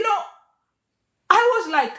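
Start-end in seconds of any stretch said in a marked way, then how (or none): none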